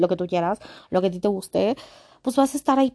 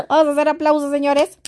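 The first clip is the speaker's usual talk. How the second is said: laughing; 8 semitones higher, 7 dB louder than usual. Real, not acted. Vlogger calls for applause while laughing at herself, giggly. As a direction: call for applause through laughter, higher and louder.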